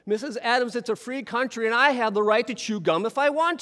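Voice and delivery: defiant repeat. Retold or imitated. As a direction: say it again, defiant this time. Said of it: "I'm gonna do this again".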